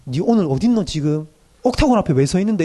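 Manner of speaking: a hint of a Busan pastor, half-scolding; lightly voiced Gyeongsang pastor grilling a youth; Gyeongsang-dialect pastor checking up. Pastor checking on a youth at the club, mock-stern in light Gyeongsang dialect. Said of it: "이러면".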